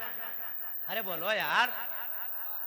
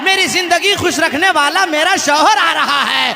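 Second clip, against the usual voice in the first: loud, ringing sermon delivery, rising in pitch; impassioned preacher's cry; full-force preaching; 6 semitones higher, 11 dB louder, slower than usual. Acted. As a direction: preach the miracle story to a big crowd, building to a drawn-out high climax.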